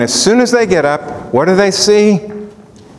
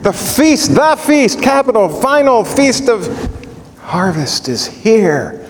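Peter's thoughts, raised high with eager joy; ecstatic Peter; 6 semitones higher than usual. Retold or imitated. Peter realizing the final feast is here, ecstatic. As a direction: voice Peter seeing it all come together. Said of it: "He's ecstatic".

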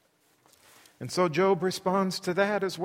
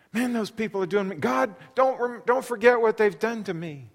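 urgent plea; 7 semitones higher, 6 dB louder than usual. Acted. Crying to God for help.

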